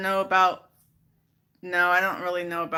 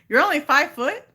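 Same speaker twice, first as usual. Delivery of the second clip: ordinary talk, nothing marked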